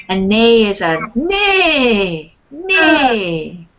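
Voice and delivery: whinnying neigh, slow and drawn; neighing like a horse. Shown as a demonstration. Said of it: "the words are trying to mimic".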